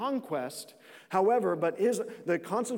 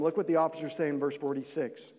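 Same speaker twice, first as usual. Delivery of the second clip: normal talking voice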